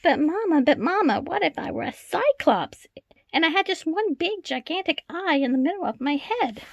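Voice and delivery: put-on an eager child asking Mama what if; child begging Mama, high and bright; pleading child. A narrator's reading. Child's voice in read-aloud story.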